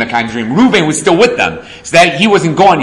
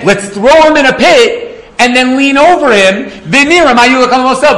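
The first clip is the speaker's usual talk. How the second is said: scheming brothers; 8 semitones higher, 4 dB louder than usual. Acted. Brothers scheme over the pit.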